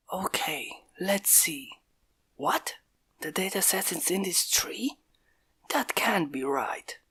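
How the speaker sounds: hushed, breathy whisper while hiding. Acted. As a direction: whisper so nobody nearby hears. Sneaking humans hiding by tree.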